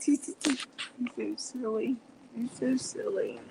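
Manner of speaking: soft, high little coo with a giggle; fond cooing. Real, not acted. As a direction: coo it quietly over a sleepy pet. Cooing over her sleeping cat.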